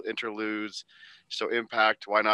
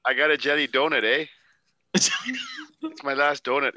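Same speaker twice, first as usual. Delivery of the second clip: mock Canadian hoser; 4 semitones higher, 4 dB louder than usual. Hoser bit, playing a Canadian.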